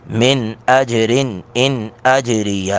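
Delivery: من اجرن ان اجریہ with each نون said clearly, no nasal merge; clear izhar. Shown as a demonstration. Teacher demonstrating izhar to students, slowly. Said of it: "یہ تینوں جگہ پہ اظہار ہوگا".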